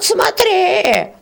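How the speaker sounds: very high threatening cry; angry warning. Acted. Old beetle shrieks a warning at the peasant. Heated quarrel.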